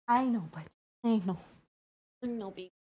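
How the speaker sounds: soft, tender reassurance to pet; soft soothing. Real, not acted. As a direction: soothe the pet gently and quietly.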